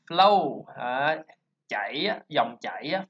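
ordinary talk

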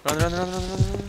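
urgent loud shouts of run; being chased. Real, not acted. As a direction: yell to flee, fast.

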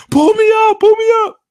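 very high, loud shouted plea; mock scream. Person hanging off a cliff shouting for rescue.

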